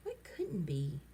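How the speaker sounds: soft low whisper. Acted. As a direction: breathe it out quietly. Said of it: "Tom whispered".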